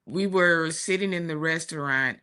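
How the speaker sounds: normal talking voice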